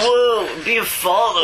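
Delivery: high, loud surfer-dude drawl; Keanu Reeves voice. Pretend. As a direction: whoa it out like a stunned stoner. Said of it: "It's better than Keanu Reeves".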